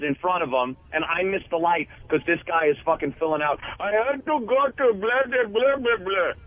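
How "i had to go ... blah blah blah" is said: whiny excuse. Retold or imitated. Mimicking a slow driver's excuse.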